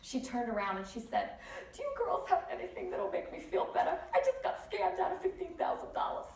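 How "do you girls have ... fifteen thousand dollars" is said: high, laughing mock New York lady; New Yorker lady. Imitating the New Yorker in the taxi, high and laughing. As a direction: play the brassy cab passenger, giggling. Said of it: "total New Yorker lady".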